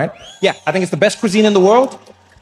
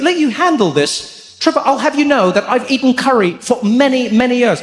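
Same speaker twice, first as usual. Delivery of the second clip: indignant friend; 8 semitones higher than usual. Retold or imitated. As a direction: bristle with wounded pride. Steve offended.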